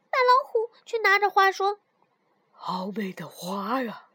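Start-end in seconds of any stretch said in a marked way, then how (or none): 2.5-4.1 soft, slow, airy murmur; admiring tiger. A narrator's reading. Tiger holding the flower mouse fetched, hushed admiration. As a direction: swoon softly over the flower.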